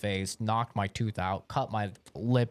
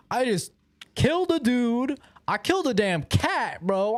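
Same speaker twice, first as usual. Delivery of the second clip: panicked disbelief; 10 semitones higher, 3 dB louder than usual. Retold or imitated. Panicked recall of killing.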